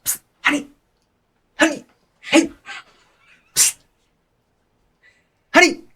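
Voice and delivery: high, squeaky hushed calls; high-pitched psst-and-call; mom calling covertly. Acted. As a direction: try to get someone's attention on the sly. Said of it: "she goes".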